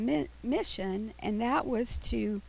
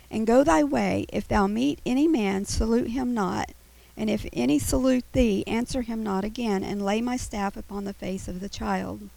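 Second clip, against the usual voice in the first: scripture reading; 5 dB louder than usual. Acted. Reads Elisha's words aloud.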